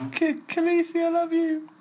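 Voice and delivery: high, crooning dragon; lovesick dragon voice. Pretend. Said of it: "this is my dragon".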